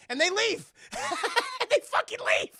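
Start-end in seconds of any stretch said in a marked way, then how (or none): 0.8-1.6 high, loud burst of real laughter; laughing. Real, not acted. Streamer cracking up at the plot, real high laugh. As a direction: burst out cackling.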